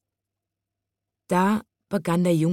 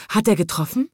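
dismayed question, pitched up; bestürzt; 4 semitones higher, faster than usual. A narrator's reading. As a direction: ask it with mild alarm. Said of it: "fragte er bestürzt". (bestürzt gefragt, mit etwas höherer Stimme)

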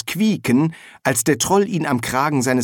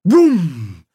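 high, loud 'wumm' with a humming tail; booming thud; 6 semitones higher, 6 dB louder than usual. A narrator's reading. Narrator imitates a boom from afar, resonant. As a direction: voice a resounding distant boom. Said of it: "Aus der Ferne ertönte ein Wumm".

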